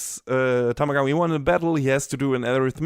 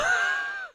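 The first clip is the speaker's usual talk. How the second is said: high squeal of glee; 6 dB quieter than usual. Real, not acted. Delighted by the plot twist.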